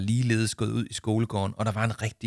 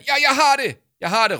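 much higher, loud triumphant yell; excited shout; 8 dB louder than usual. Acted. Arthur shouts to Jama, excited loud yell. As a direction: blurt it out at full voice, eureka. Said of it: "råbte Arthur".

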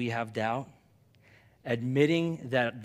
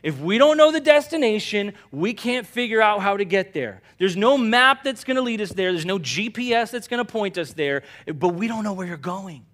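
Thomas pleading; 8 semitones higher, 11 dB louder than usual. Retold or imitated. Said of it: "he said".

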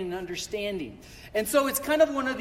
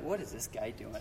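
a much softer, lower mock mutter; muttered puzzled aside; 11 semitones lower, 13 dB quieter than usual. Congregation staring at the pastor, baffled quiet mutter. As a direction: voice the baffled crowd under your breath. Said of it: "everybody's looking at me like".

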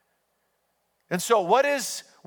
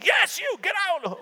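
high-pitched mock yell; imitated teacher's yell; 11 semitones higher than usual. Fed-up math teacher ordering a student out, high imitated yell. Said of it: "just started yelling".

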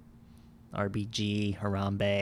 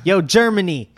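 brash shout into the mic; loud showman shout; 8 semitones higher, 10 dB louder than usual. Pretend. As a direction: hype call to the crowd. [name] grabs mic at UN.

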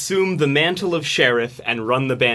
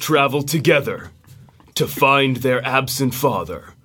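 dramatic movie trailer narrator; 2 semitones lower than usual. Acted. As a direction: epic trailer read, fighting back laughter. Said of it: "do their best trailer voice".

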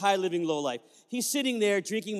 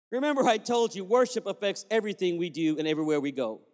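usual speaking voice

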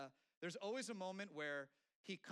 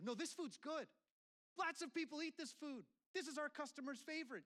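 mock offended protest; 12 semitones higher than usual. Restaurant owner talking back to the chef, imitated indignation. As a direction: defend your food, hurt and outraged. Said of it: "they get mad and they go".

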